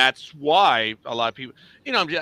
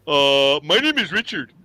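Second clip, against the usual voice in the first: impatient introduction; 8 dB louder than usual. Retold or imitated. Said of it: "I would tell 'em".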